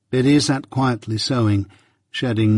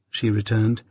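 plain reading voice

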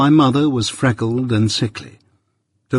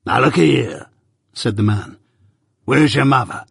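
gruff convict. Acted. Convict questions boy.

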